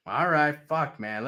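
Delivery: ordinary talk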